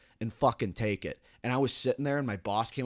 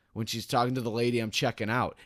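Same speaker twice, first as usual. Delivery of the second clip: ordinary talk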